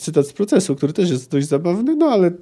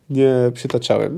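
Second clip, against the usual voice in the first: plain reading voice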